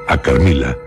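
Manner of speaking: ordinary talk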